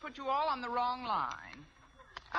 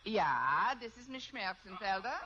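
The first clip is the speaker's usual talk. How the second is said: hammed-up German accent posing as a teacher; fake German accent. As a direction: play a comic German schoolteacher. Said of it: "If I can do a decent German accent".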